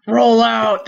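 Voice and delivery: half-hearted robot leader attempt; a quick try at a robot hero's command; lightly voiced commanding robot; Optimus Prime. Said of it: "three Optimus Primes in one podcast".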